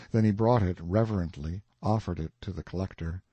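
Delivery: plain reading voice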